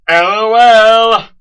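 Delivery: loud, high, slow spelled-out L O L; mock exclaim. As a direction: draw out each letter like a big joke. Mocking own joke loudly.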